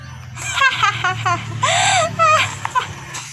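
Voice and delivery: very high gloating laugh; greedy cackle. Acted. Greedy pair hoping for treasure, high cackle. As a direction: cackle in shrieky staccato bursts.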